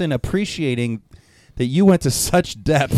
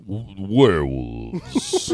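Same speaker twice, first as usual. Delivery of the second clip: low, slow werewolf voice; 8 semitones lower, 4 dB quieter, much slower than usual. Pretend. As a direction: growl it out like a werewolf. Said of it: "I need you to use your werewolf voice".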